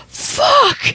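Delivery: high, sharp shocked cry; shocked yell. Retold or imitated. Ex sees her knocked out.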